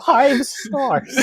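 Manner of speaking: a jubilant shout of five stars; excited shout. Real, not acted. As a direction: cheer it like a crowd.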